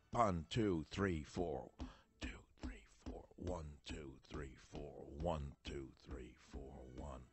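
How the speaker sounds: very soft, low beat count; hushed, steady counting in time; counting along. Shown as a demonstration. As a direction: murmur the count evenly under music. Counts along quietly with the music.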